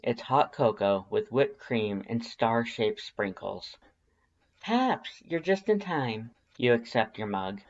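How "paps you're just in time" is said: cheerful greeting. Acted. Greeting Papyrus arriving with cocoa.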